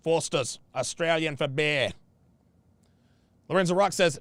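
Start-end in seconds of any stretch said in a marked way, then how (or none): 0.0-1.9 mock Australian ad slogan; Australian accent. Shown as a demonstration. Streamer quotes a beer slogan to his viewers, put-on Australian accent. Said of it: "That's my best Australian accent".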